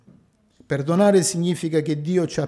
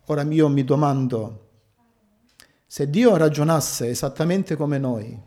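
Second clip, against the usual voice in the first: usual speaking voice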